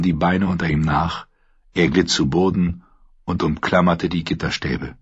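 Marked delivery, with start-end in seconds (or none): none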